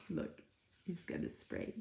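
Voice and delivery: hushed whispered aside. Real, not acted. Teacher whispering to class about Sparkle. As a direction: whisper it so the pet won't hear.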